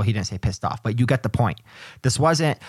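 usual speaking voice

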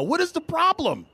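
high-pitched mock confrontation; comic quarrel challenge; exasperated demand. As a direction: demand it, voice jumping up.

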